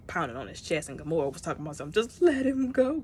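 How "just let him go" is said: high, loud pleading cry; Gamora's plea. Retold or imitated. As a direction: beg him to let go, pitched up and loud.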